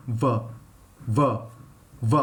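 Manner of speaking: "V" buzzed low, voice on, teeth on lip; voiced v. Shown as a demonstration. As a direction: hum through "v" with the voice on. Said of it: "Now voice lao".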